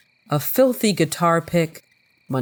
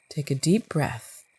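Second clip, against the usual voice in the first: self-calming; 6 dB quieter than usual. Real, not acted. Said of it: "I think I need to calm down".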